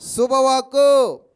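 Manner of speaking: loud and very slow, each syllable drawn out; stretched, loud శుభవాక్కు; emphatic answer. Shown as a demonstration. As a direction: make the congregation hear it. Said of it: "చెప్పండి, ఏంటంటే అది?".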